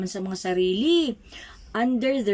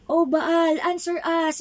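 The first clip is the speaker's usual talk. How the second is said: calling out to Baal; 7 semitones higher than usual. Acted. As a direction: cry out to Baal, pleading. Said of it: "they shouted".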